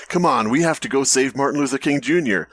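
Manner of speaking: usual speaking voice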